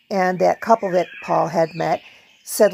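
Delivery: ordinary delivery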